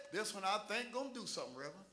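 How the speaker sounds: soft, low retold remark; understated aside. Father speaking to the reverend about his daughter, quiet understated remark. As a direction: say it quickly and quietly, trailing away.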